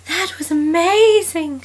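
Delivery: put-on high, gushing girlish swoon; swooning Liz. Reading Liz's line to John, high swooning gush. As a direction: gush it like a starstruck girlfriend.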